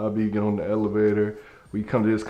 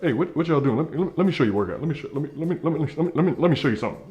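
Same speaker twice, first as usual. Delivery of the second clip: rapid, stumbling, higher "let me, let me"; fast talker; 6 semitones higher, much faster than usual. Retold or imitated. Gym dude pushing his workout, rapid and stammering. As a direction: rattle it off like a wired hustler. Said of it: "He talked fast".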